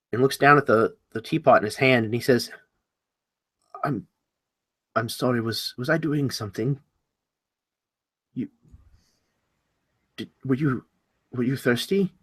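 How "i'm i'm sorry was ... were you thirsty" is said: soft, halting, losing the thread; confused, forgetful. Acted. Host forgetting why he fetched teapot, confused. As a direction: grope for words, quieter and unsure. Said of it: "you see his facial expression change".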